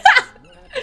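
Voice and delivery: high, loud, alarmed squeal, half-laughing. Retold or imitated. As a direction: shriek it out, startled and laughing. Donna startled by Lou's nightmare.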